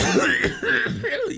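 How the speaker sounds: high, spluttering acted cough; coughing fit. Captive with blood on his lips, high hacking cough. Game master acts out bleeding prisoner.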